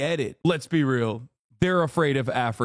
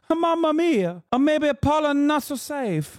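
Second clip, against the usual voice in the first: much higher, hammed-up Italian exclamation; Italian accent, pitched way up, mocking dismay; 11 semitones higher than usual. Streamer impersonates the Italian tourist, high and theatrical in an Italian accent. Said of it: "Now I'm saying".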